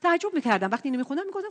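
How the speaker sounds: usual speaking voice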